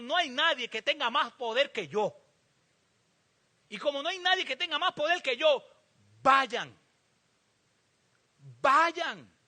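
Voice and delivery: building to a loud command; rising loud commission; commanding Lord. Acted. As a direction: send them out with full force. The Lord sends them out.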